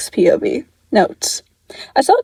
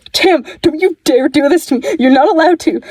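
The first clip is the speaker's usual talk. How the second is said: higher, frantic plea to his brother; desperate pleading; 6 semitones higher than usual. Acted. Dick on the phone begging Tim not to go, desperate higher plea. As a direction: beg him not to die, voice pitched up.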